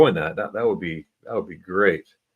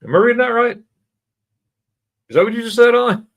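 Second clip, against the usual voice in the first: high, loud surprised question; 7 dB louder, faster than usual. Real, not acted. Host questioning a viewer's odd answer, surprised and high. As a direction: sound genuinely taken aback.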